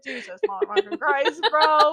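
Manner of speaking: mock outrage, breaking into laughter; exasperated outburst. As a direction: imitate a fed-up mom exclaiming. Mother's exasperated reaction in retold scene.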